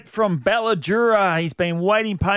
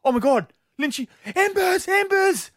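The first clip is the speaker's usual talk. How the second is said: higher and higher, starstruck fan; a gushing fan spotting footballers; excited fan; 12 semitones higher than usual. Retold or imitated. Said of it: "and he goes".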